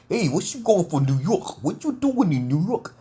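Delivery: high-pitched mock New York street accent; imitated American street accent. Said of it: "my fellow brother talking like this".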